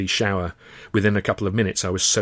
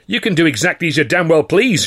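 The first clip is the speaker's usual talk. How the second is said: loud, higher and bitter; shout; 8 semitones higher, 8 dB louder than usual. Acted. Manager shouting at the older man, defiant. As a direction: shout it, losing ground. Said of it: "shouted Carno".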